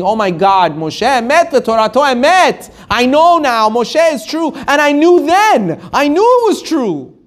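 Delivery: high, wailing cry of too-late admission; anguished confession. Acted. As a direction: cry the truth out, pitch climbing and held. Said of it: "everyone hears them saying".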